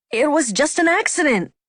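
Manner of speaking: high-pitched protest of innocence. A narrator's reading. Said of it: "Alan protested".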